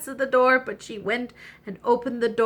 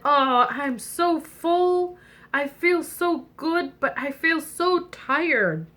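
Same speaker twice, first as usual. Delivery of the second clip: very slow, drowsy and full; sleepy, contented frog; 6 semitones higher, slower than usual. Acted. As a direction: drag the words out as if about to nod off.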